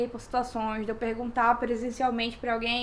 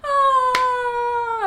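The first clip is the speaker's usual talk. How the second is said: relieved ah; 10 semitones higher, 5 dB louder than usual. Retold or imitated. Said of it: "aí na terceira".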